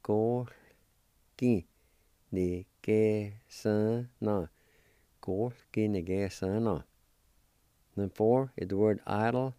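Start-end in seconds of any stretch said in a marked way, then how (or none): 2.3-4.5 "ni que son no" slowly, stress on que; slow demo of "ni que son no", falling at the end; slow syllables. Shown as a demonstration. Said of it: "And together".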